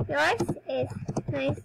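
normal talking voice

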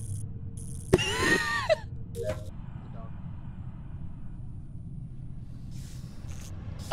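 long silent wheezing laugh; breathless wheeze laugh. Real, not acted. Friend wheezes at Simon Says joke, breathless laugh.

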